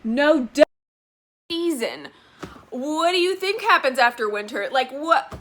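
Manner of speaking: loud, high, incredulous outburst; exasperated rant. Acted. Reader scolding an author over an obvious line, exasperated. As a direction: throw it at the author in disbelief.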